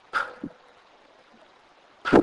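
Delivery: P as a breath-puffed pop, no vowel; letter sound demo. Shown as a demonstration. Teacher shows how P H is pronounced, aspirated pop. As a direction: pop P alone with a puff of air. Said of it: "P H is pronounced as".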